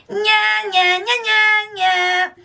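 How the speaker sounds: high, taunting playground nya; annoying twang. Shown as a demonstration. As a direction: taunt like a bratty ten-year-old, pinched and high. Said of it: "This is twang".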